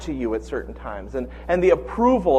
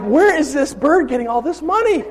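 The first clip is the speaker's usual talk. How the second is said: laughing disbelief; 10 semitones higher than usual. Retold or imitated. Retelling his own amazed reaction.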